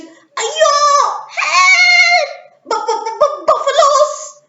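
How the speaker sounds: frightened cry. Acted. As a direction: stammer in terror at the buffaloes. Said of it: "she said".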